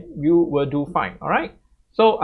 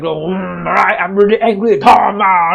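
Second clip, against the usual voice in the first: hammed-up anger, louder; theatrical fake fury, louder; mock angry reading; 3 semitones higher, 6 dB louder than usual. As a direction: overact being angry at Tom, louder. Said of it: "be really angry and show an angry face".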